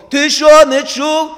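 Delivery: sharp loud scolding; angry shout. Retold or imitated. Father scolding his son for not listening. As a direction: bark it at the child who ignored you. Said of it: "Який мій голос?".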